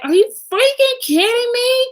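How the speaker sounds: high, loud, incredulous cry of outrage; outraged disbelief. Retold or imitated. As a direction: let the disbelief explode upward. Retelling furious reaction to fraud claim.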